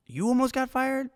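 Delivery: high, incredulous echo of the question; surprised. Retold or imitated. Narrator retells his own disbelief.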